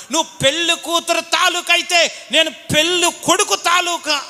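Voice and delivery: put-on drunken uncle yelling. Bride's drunk uncle yelling at the groom's uncle. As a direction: bark it back like a drunk uncle picking a fight.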